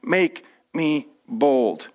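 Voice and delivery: slow, low, hushed prayer. Acted. Preacher modelling the prayer for the congregation, slow and low. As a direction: drop into a slow earnest prayer. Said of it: "the courage to pray".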